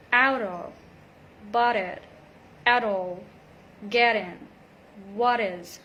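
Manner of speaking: out of, bought it, at all, get in, what is linked with D flaps. Shown as a demonstration. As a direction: join the words with a tapped T. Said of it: "we link the words together".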